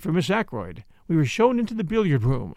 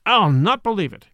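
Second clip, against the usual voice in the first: louder, higher outburst of refusal; indignant disbelief; 4 semitones higher, 5 dB louder than usual. A narrator's reading. Inspector refusing to believe Poirot, indignant and loud. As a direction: thump the words out in protest. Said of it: "brought his fist down with a thump".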